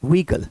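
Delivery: व्हीकल said "vee-kal", two syllables; correct silent H. Shown as a demonstration. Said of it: "हे का word नहीं निकलेगा".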